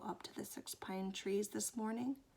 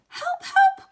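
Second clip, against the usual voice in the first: shrieking, very high and loud cry for help; Piglet crying out; 16 dB louder than usual. Acted. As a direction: squeal in panic. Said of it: "cried Piglet".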